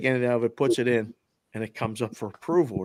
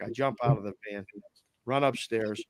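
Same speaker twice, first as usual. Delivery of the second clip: ordinary delivery, nothing marked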